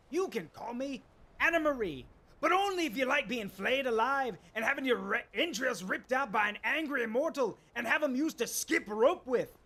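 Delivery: manic Scottish-lilted mad god, swinging from hushed to booming; theatrical mad-god voice with wild pitch jumps; Sheogorath impression. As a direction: play a gleeful, unhinged god of chaos. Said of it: "you do a good impersonation".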